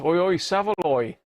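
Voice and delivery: a quick, jokey Cockney "Oi, oi, Savaloy"; hammed-up Cockney market-trader call; cheeky Cockney shout-out; mock Cockney. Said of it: "Don't try, um, Cockney accents".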